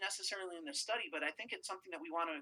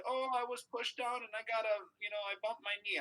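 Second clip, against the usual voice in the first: lightly mimicked hurt kid, pitched up; imitating whining child; 4 semitones higher than usual. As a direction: put on a mild childish complaint. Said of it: "when the kids come in and they're crying".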